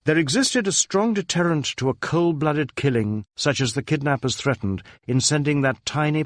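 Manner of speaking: plain reading voice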